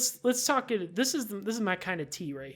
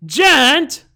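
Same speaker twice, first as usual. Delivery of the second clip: loud, higher call of the name; 7 semitones higher, 15 dB louder than usual. Acted. Girl calling out to the player, loud. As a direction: call out his name to stop him.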